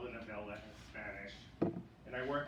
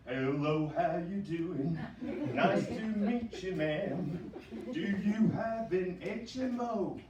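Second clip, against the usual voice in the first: louder, slow Elvis-style drawl; [name] impression; 6 semitones higher, 8 dB louder, slower than usual. Clinic receptionist greeting patients, done as a slow, louder Elvis. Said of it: "the [name] dialect".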